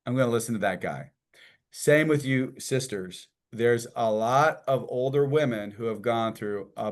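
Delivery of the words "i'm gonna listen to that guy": ordinary talk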